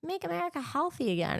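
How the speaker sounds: high, gushing mock-excited voice; mock excitement. Recalling how excited she was about MAHA, high and gushing. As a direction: gush it like a starry-eyed fan, high. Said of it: "I was excited".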